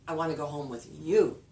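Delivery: "you" loud and lifted at the end; stress on "you". Shown as a demonstration. Answering who to go with, stress on "you". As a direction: save the punch for "you". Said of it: "Stressed word, you".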